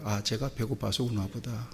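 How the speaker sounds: hushed motherly realization; gentle mother's thought. Retold or imitated. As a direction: think it tenderly to yourself.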